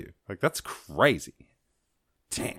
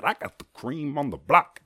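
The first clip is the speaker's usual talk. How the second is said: rapper voice; 6 semitones higher than usual. Retold or imitated. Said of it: "He said".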